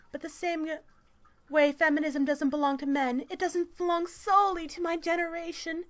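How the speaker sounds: high, loud mock-activist reading voice; strident article read. Reading a feminist article aloud to mock it, strident.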